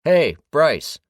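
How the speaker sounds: loud, high call across the camp; shouted. A narrator's reading. James calls Bryce out of the pavilion, loud shout. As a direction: call out to get his attention. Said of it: "then shouted".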